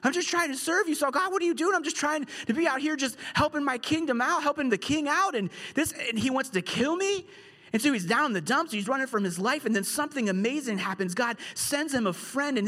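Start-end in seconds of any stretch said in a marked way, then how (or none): 0.0-7.2 higher, pleading and bewildered; a wronged man crying out to God; distressed pleading. Retold or imitated. Pastor voicing David hunted by Saul, anguished plea. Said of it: "He's like".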